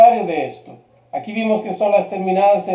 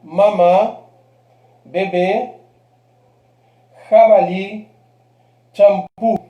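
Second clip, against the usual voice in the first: "Mamá, bebé, jabalí, champú" each stressed on its final syllable; last-syllable stress; slower than usual. Shown as a demonstration. Teacher giving examples of agudas, last syllable strong in "Mamá, bebé, jabalí, champú". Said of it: "palabras con la última sílaba fuerte".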